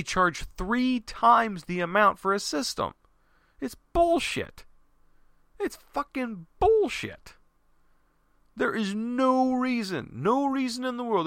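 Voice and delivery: high-pitched, drawn-out exasperation; outraged rant. Real, not acted. Ranting in outrage at Apple pricing.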